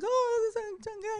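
high wailing mock sob; mock crying. Crying to the police dispatcher, high mock wail. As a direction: whine it through fake tears. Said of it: "So now I'm crying".

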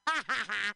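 loud, staccato ha-ha-ha; hammed-up victory laugh; mock gloating laugh. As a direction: cackle in pretend triumph.

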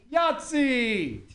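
drawn-out, falling mock holler; stretched-out imitation yell; mock mom yell. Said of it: "whenever mom yells".

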